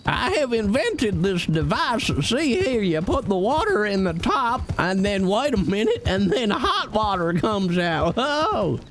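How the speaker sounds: high, excited eccentric inventor; proudly showing off a silly gadget; crazy old inventor. Pretend. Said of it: "crazy crackpot man".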